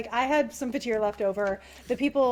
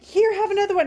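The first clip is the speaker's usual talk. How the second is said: eager offering; 7 semitones higher, 7 dB louder, faster than usual. Retold or imitated. Offering bars to the djinn.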